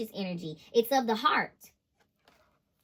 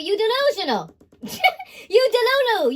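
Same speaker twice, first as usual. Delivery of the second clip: high, loud, laughing taunt; laughing mockery; 9 semitones higher, 7 dB louder than usual. Real, not acted. Reader laughs at the manipulator, mocking them. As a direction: laugh it in their face.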